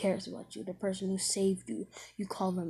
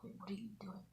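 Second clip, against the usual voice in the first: whispering; 17 dB quieter, faster than usual. Retold or imitated. Haku voiced in retold scene.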